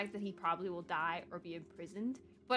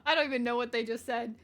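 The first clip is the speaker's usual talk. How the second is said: ordinary talk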